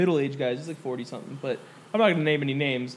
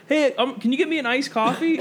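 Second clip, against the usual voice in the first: higher, lightly voiced customer request; mimicked customer; 7 semitones higher than usual. Retelling the man's request at work, lightly mimicked. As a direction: imitate someone casually asking a favour.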